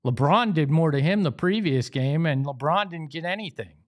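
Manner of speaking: normal talking voice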